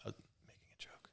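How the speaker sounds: barely audible whisper; tiny, whispered protest; deflated whisper. Real, not acted. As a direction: let it die away under your breath. Deflated after joke falls flat.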